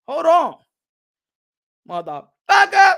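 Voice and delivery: loud sharp burst on hold on; sudden outburst. Real, not acted. Streamer blurting at his chat, loud. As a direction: blurt it out loud, dragging the curse.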